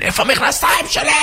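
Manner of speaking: high-pitched frantic demand; acted high yell of irritation; annoyed yell. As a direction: squawk it in a flustered temper. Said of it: "מתעצבן".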